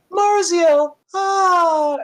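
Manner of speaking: very high, loud dreamy squeal; swooning gnome girls. Acted. Gnome girls hearing their idol's name, swooning. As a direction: sigh the name loudly, about to faint. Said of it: "they faint and the other one grabs them".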